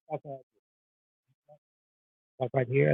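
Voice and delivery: off-mic, faint and distant; away from the mic. Shown as a demonstration. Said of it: "if I'm talking like that here".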